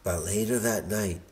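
plain reading voice

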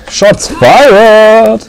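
slow, high excited call. Real, not acted. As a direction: relish the moment, drawn out. Kommentar zum Kampf. (aufgeregt und gedehnt)

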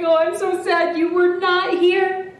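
lightly wailed sadness; mock sad. A narrator's reading. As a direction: voice a sad character for children. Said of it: "he leans down, he's like".

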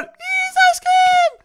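put-on squeaky high-pitched spider girl, slow and sweet; girly high spider girl voice. (çok ince sesli örümcek kız)

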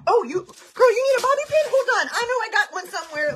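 very high, loud, chirpy helper voice with a laugh; eager helper. Retold or imitated. Livestreamer mocking her people-pleasing self, high chirpy helper voice. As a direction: play the overeager friend digging for a bobby pin.

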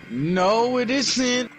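ordinary talk

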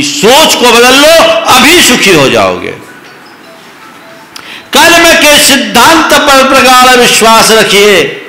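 high, loud emphatic sermon; full-force preaching. Acted. Preacher urging the audience to absorb the mantra, loud and high. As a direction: drive the mantra home to the crowd.

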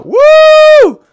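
high loud whoop; excited cheer. Real, not acted. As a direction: whoop with delight.

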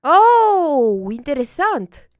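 big loud "oh" swooping up and down; impressed oh. Real, not acted. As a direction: draw out a loud, amazed "oh". Impressed by the prisoner toilet.

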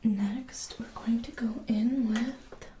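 ordinary talk, nothing marked

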